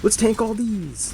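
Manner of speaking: high, slow, hammed-up war cry; mock heroic battle cry. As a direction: bellow it like a reckless hero. Mocking his own reckless play.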